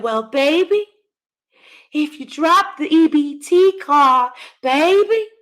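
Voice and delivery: high, slow sugary fake-sweet mom; sarcastic imitation, baby rising high; mock soft voice. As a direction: ham up a fake gentle mother. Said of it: "she's trying to say that she said".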